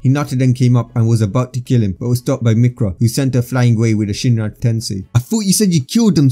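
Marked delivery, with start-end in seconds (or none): 5.1-6.3 high-pitched, mild mock yell; understated outcry, pitched up; yelled accusation. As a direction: accuse in a raised pitch without real volume. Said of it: "Sasuke yelled out".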